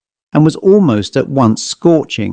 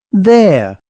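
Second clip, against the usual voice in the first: exclaimed; 6 semitones higher than usual. A narrator's reading. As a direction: burst out as if proven right. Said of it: "he exclaimed".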